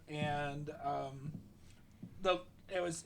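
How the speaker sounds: ordinary delivery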